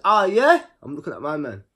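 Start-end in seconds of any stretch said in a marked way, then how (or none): 0.0-0.6 surprised. Retold or imitated. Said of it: "I said".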